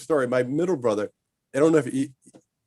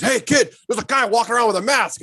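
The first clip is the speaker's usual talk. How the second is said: high, louder alarmed warning; alarmed driver; 10 semitones higher, 4 dB louder than usual. Retold or imitated. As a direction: call out to a kid from the car. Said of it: "He goes".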